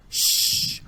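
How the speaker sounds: drawn-out voiceless shush; hushing shh. Acted. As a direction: hush a struggling victim. Attacker hushes victim before hurting him.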